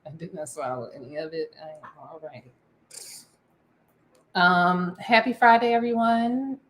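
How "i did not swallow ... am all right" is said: soft, low self-reassurance; calming down quietly. Real, not acted. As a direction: settle yourself down. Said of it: "I'm gonna calm down".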